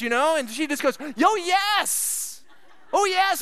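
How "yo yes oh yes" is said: high, breathless excited yes; imitating the fiancée's giddy yes. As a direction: gush out the yes. Wife finally accepts proposal.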